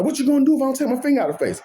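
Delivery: high, fast taunting dare; retold boss daring him, pitched high and fast; mock challenge. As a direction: fire back a cocky challenge, quick and high. Coworker challenging him, finger in face.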